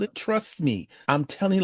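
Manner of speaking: ordinary talk, nothing marked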